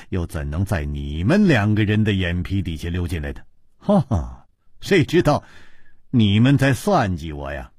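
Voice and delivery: small laugh and sigh, then musing; wry amusement. Acted. Chu Liuxiang admits to his two friends he was fooled, wryly amused. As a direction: muse low, amused at being fooled. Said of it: "楚留香也禁不住 莞尔而笑".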